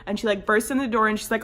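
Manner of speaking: normal talking voice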